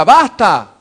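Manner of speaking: a loud, sharp "Basta"; preacher declaring enough, loud and sharp; emphatic cry. Acted. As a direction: slam the word down. Crying out enough.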